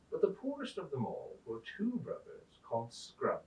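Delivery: expressive storybook reading; story narration. A narrator's reading. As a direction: tell it as if to children by the fire. Reads a story aloud.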